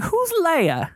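high, clueless Who's Leia; mock clueless. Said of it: "You were like".

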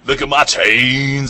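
slow bragging rapper; rapper boast. Retold or imitated. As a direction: show off the chains slowly. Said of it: "they'll be like".

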